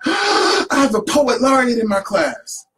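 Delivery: high, loud, slow proud announcement; teacher bursting with pride on the phone; thrilled teacher. Retold or imitated. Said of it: "and she went".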